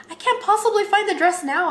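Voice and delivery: high-pitched, exclaimed protest; mock disbelief. Bride with another appointment booked, mock disbelieving protest. Retold protest at finding dress early.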